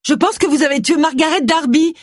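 blurted too loud and high; a nervous accusation, far too loud; abnormally loud. A narrator's reading. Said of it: "d'une voix qui lui parut anormalement forte".

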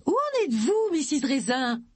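high-pitched call through a door; raising the voice. A narrator's reading. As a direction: call out to someone shut in. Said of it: "en haussant la voix".

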